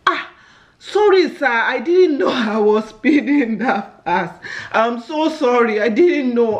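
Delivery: put-on apologetic, natural African accent. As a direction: plead innocence in your own African accent, drawn out. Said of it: "Speak like your normal accent".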